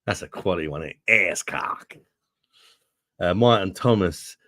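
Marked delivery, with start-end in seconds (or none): 1.1-1.9 exaggerated American vowels; mock American accent. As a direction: savour it in a put-on American accent. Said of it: "idea what your accent might be like".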